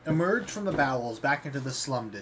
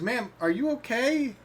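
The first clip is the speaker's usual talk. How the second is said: put-on concerned young man; 9 semitones higher, slower than usual. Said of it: "and he goes".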